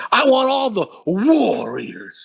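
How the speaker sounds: slow, commanding gang boss decree; slow gang leader. Retold or imitated. Gang leader commanding all the gangs, slow decree. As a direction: lay down the order slowly and grandly. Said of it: "the way he says it".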